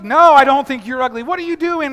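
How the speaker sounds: high, loud protest; husband shouting back in frustration; exasperated shout. Retold or imitated. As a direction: throw up your hands and yell it. Husband protests in acted quarrel.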